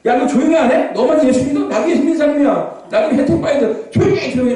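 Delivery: high-pitched, hammed-up annoyed scolding; irritated bystander. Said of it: "막 시끄러우면 열받잖아요".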